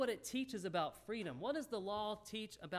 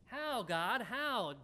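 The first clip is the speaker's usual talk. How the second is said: a touch of anguish, pitched up and louder; lamenting plea; 4 semitones higher, 5 dB louder, much slower than usual. Retold or imitated. Abraham crying out to God, a raised, lightly acted lament. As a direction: cry out to God with mock despair. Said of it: "Abraham's lamenting".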